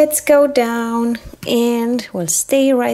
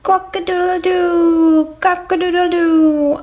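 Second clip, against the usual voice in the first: rooster crow; 2 semitones higher than usual. Pretend. Rooster announcing itself for the train, crowing. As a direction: crow like a rooster, holding one note. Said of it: "I am a rooster".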